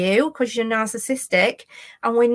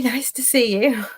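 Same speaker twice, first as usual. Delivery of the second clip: laughing; 2 semitones higher than usual. Real, not acted. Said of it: "getting a bit overexcitable".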